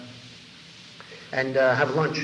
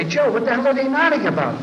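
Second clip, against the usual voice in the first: baffled outcry; 9 semitones higher, 6 dB louder than usual. Retold or imitated. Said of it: "I say to Joe".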